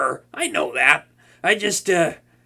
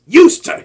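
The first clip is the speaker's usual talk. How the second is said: "Used" jumps high and loud; stress on USED; 11 semitones higher, 11 dB louder than usual. Acted. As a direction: hammer home "Used to". Insisting they were only friends.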